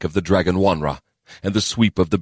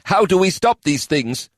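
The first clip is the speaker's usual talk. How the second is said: a hint of a higher, heavy elf; lightly voiced elf officer; 12 semitones higher than usual. A narrator's reading. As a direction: report the bad news plainly. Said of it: "Kadan stammered".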